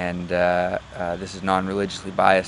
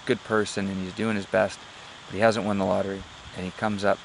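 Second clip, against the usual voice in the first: normal talking voice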